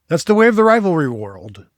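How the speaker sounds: loud, high proclamation that falls away; mock-grand proclamation. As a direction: proclaim it big, as a playful slogan. Mock-grand line, then tongue-twister repeat.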